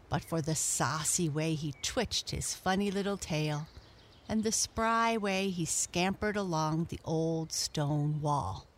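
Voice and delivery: usual speaking voice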